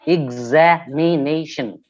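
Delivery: "examination" split into distinct beats; syllable by syllable. Shown as a demonstration. Teacher showing a polysyllabic word, syllable by syllable. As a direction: sound out each syllable of "examination" separately. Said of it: "we have polysyllabic words like".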